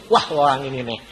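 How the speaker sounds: ordinary talk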